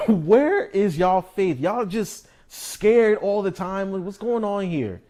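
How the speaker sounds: high, loud, slow incredulous scolding; exasperated scolding. Retold or imitated. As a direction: drag it out like you can't believe them. Yahushua scolding the disciples voiced.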